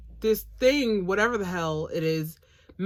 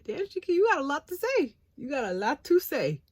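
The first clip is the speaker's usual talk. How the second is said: mock teasing; 7 semitones higher than usual. Teasing reply to Shiki.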